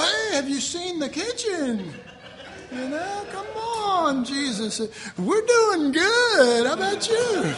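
high, forced cheerful distraction; mock nervous cheer. Believer distracting Jesus from the closet, high forced cheer. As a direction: chirp brightly to steer Jesus away.

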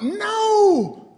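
loud high "No!"; loud emphatic refusal. Real, not acted. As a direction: shut it down hard. Answers own question emphatically.

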